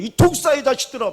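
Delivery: loud, high rebuke hitting 독사의; Jesus rebuking the crowd, loud and high. Retold or imitated. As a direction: thunder it as a rebuke. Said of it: "예수님이 그랬잖아요".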